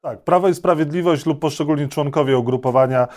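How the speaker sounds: normal talking voice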